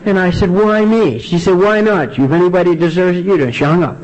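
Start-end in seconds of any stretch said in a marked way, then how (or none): 0.5-1.2 much higher-pitched mock complaint; retold plaintive protest. Caller protests to his ex-wife, mock plaintive. 1.5-3.5 high-pitched, comic ex-wife comeback; retold scornful retort. Ex-wife answers his self-pity, retold scornful retort.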